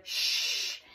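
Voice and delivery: whispered shh; shushing. Acted. Whispered secret password.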